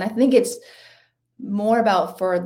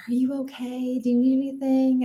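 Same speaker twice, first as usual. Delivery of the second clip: higher, worried passerby voice; concerned stranger; 6 semitones higher than usual. Retold or imitated. A couple at the roadside park asks the crying runner, higher and worried. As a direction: mimic a kind couple checking on a runner. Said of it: "a couple stopped me and they're like".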